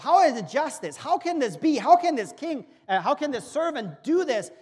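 loud, high, righteous disbelief; the preacher voices his own outrage, loud and high; indignant outrage. Retold or imitated. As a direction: rail at the injustice. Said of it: "every little hair goes up".

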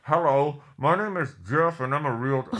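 muffled voice from inside a box; box over head. Pretend. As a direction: talk as if a box covers your head. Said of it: "It'll probably sound more like this".

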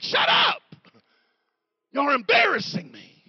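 very high, loud mock-mortified outburst; embarrassed yelling. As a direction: yell at your friends as if they shame you. Jesus embarrassed, silencing the disciples.

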